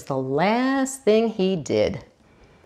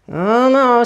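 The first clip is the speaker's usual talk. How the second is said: ordinary delivery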